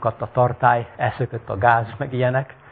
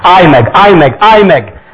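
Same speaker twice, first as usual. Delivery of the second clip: higher, loud desperate shout; crowd calling out; 6 semitones higher, 12 dB louder, slower than usual. Acted. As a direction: cry out for him to stop. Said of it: "Kiáltozták a vakok".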